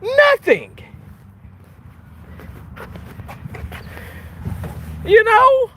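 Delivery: high, slow, mock innocent voice; sarcastic self-praise. Real, not acted. Defending his rant to viewers, high mock innocence. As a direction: play innocent, high and drawn out. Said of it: "I communicated beautifully".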